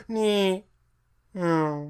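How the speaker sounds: high sliding whoosh that rises then drops; Doppler sound effect. Shown as a demonstration. Describing Wi-Fi summoning, a high Doppler swoosh. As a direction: sing the word like a car passing by. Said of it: "like Doppler effect".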